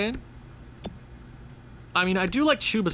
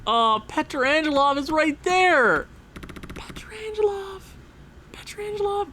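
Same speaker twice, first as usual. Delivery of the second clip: excited squeal; 10 semitones higher than usual. Real, not acted. Spots favourite player available.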